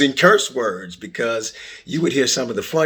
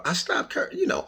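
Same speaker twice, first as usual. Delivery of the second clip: ordinary delivery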